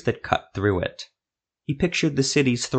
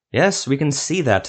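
sarcastic; 6 semitones higher, 3 dB louder than usual. A narrator's reading. Sorcerer sarcastic at Zevo.

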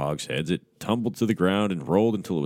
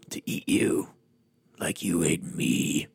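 slow breathy rasp, not a roar; whispered monster threat; slower than usual. Acted. Rawhead pouncing on the hunter, hushed and slow. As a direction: lean in and rasp the final threat. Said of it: "Rawhead roared".

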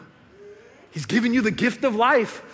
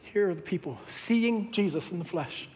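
ordinary talk

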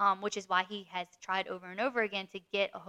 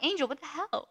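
exasperated disbelief; 6 semitones higher than usual. Retold or imitated. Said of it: "Then I'm like".

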